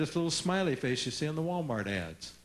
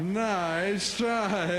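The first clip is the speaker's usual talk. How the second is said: grinning nice try; 4 semitones higher than usual. Shown as a demonstration. Parent answering a kid.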